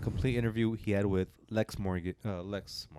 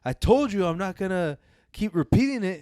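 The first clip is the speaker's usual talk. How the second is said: imitating an irritated rapper, higher and louder; 10 semitones higher, 7 dB louder than usual. Retelling [name] losing patience with the interviewer, mock angry. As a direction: snap it back like he's fed up. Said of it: "you could see [name] get mad".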